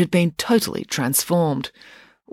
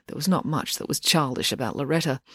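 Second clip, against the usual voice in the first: usual speaking voice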